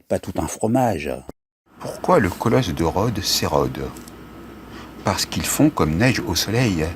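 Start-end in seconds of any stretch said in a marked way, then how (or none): none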